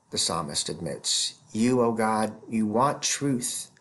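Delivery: normal talking voice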